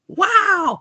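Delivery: very high, drawn-out wow; squealed mock amazement; exaggerated amazed wow. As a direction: let out a big high-pitched wow of mock awe. Mock-echoes the friend's retold wow.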